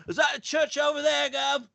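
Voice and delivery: high-pitched mock Cockney. Prince making small talk at the funeral, high Cockney. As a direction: ask it as a chirpy Cockney. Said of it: "I'd do Cockney".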